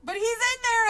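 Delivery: put-on high, loud child's protest; crying out. A narrator's reading. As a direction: cry out like an alarmed kid. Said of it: "I cried".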